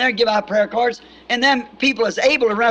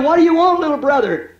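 put-on high, slightly louder child's reply; little girl answering; 7 semitones higher, 3 dB louder than usual. Scared little girl answers her brother, high child's voice. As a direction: answer your brother from the dark.

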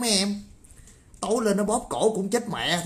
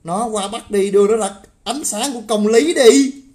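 louder and higher to the end; furious shout rising; 7 semitones higher, 10 dB louder than usual. Real, not acted. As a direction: build to a full yell. Heated rant, climax. (hét to, giọng vút cao)

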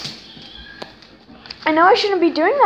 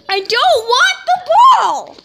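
put-on whiny kid voice; 8 semitones higher, 5 dB louder than usual. Voicing the dog.

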